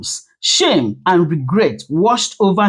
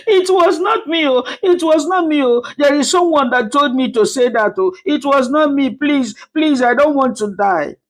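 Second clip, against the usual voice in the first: tearful, much higher pleading denial; 7 semitones higher than usual. Acted. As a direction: deny it through tears, pitched up. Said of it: "burst into tears and said".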